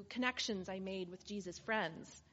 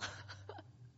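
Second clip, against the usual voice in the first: choked sob; 11 dB quieter than usual. Real, not acted. Overcome, reliving being healed.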